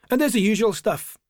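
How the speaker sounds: a touch of Glaswegian, casually passing on tips; Glaswegian traveller. A narrator's reading. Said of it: "a traveller from Glasgow".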